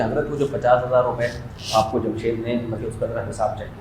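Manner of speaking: very soft imitation of a deferential questioner; hushed polite request. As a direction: ask discreetly, voice dropped right down. Acted polite request to imam for accounts. (دھیمی، مؤدبانہ درخواست کی نقل)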